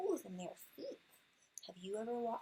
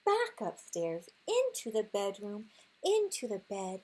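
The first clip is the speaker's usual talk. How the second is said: louder, hurried and alarmed; frantic rush; 5 dB louder than usual. Acted. Reading the dash home to her son, loud and alarmed. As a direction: bustle through it in mock panic. Hiding from the bear.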